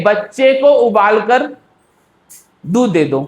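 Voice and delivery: long pause after उबाल कर, then दूध दे दो on its own; group उबाल कर with बच्चे को, then a big gap. Shown as a demonstration. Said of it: "कहाँ मैंने पॉज लिया".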